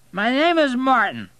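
put-on high, loud drunk insistence; slurred indignant protest; drunken man. A narrator's reading. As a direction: correct him loudly as a drunk. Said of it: "He was so drunk".

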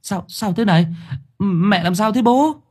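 put-on high, bewildered young son stammering. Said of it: "nó lắp bắp". (cậu con trai lắp bắp, giọng cao)

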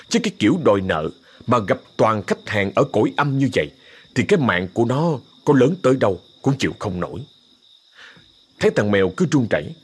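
usual speaking voice

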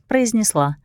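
ordinary delivery, nothing marked

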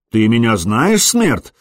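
ordinary delivery

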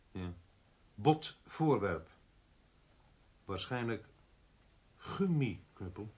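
slow, halting, repeating each phrase while writing it down; slow dictation. Acted. Inspector repeats the phone report aloud, slow and halting. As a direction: spell it out slowly, word by word, as you note it.

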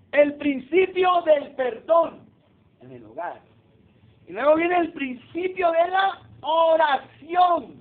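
slow, loud announcing of each point; emphatic preaching. Acted. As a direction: proclaim each principle from the pulpit.

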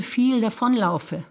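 ordinary talk

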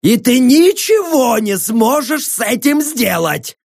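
high, slow, mocking taunt; gloating prisoner. A narrator's reading. Prisoner defies his interrogator, gloating. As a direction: taunt him high and drawn out. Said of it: "захохотал пленник".

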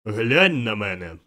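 loud, slow order; booming command. A narrator's reading. The spirit ordering timid Scrooge to look, slow booming command. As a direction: command with a giant's full voice.

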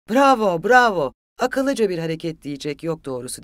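loud, sarcastic bravo; jeering loud exclamation; shouting. A narrator's reading. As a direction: shout mock applause, high then easing down. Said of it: "diye bağırdı".

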